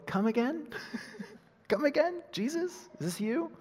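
high, questioning disbelief; puzzled double-take. Acted. Reader pausing over the Bible to question Jesus, puzzled. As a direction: query it in a high, baffled voice. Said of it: "just pause and go".

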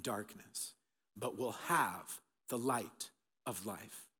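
slow, softening scripture quote; solemn, measured. Acted. Preacher quotes Jesus offering light to the congregation, solemn and measured. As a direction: let each phrase land slowly, with pauses.

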